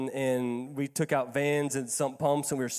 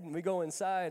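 ordinary talk

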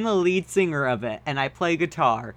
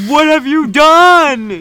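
much higher, louder, slow shout; angry shout; 11 semitones higher, 11 dB louder, slower than usual. Acted. Dumbledore storming in, shouting in fury. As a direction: roar it at them. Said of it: "Dumbledore walked in angrily".

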